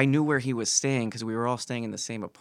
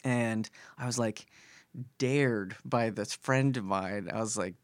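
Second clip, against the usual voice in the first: usual speaking voice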